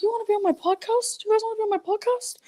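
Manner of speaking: high, eager put-on voice; hammed-up high-pitched invitation; Fraser Smith. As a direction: impersonate someone pitching a podcast. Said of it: "I could do Fraser Smith".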